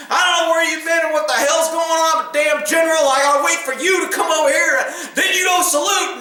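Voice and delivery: mock angry yell, pitched high; retold general yelling. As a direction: bark it like an irate general.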